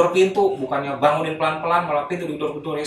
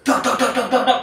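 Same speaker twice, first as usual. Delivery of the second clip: loud, high, rapid knocking noise; urgent "dok-dok-dok" knocks; imitated door banging; 10 semitones higher, 5 dB louder than usual. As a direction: mimic fists pounding on a door. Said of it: "digedor-gedor".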